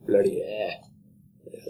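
normal talking voice

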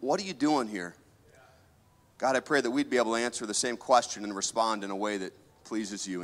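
earnest, reverent prayer voice. Acted. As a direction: pray over the congregation, sincerely. Pastor prays over the church.

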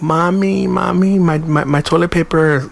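mocking a mama's boy. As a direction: whine like a kid missing his mommy. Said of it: "I'm like".

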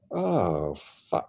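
hushed, fading disbelief; retold awe. Recalling the body regrowing, quietly awestruck. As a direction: trail into a soft, stunned swear. Body disappears and grows back.

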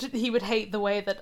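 ordinary talk

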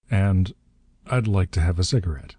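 plain reading voice

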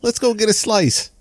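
high-pitched mock Jersey accent; New Jersey accent. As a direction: play up the New Jersey talk. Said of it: "That's what we say in Jersey".

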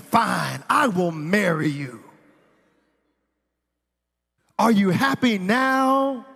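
slow exasperated concession, building to a loud, high question; grudging proposal. Retold or imitated. Boyfriend forced into proposing to girlfriend, exasperated. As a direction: give in angrily, dragging out the last words.

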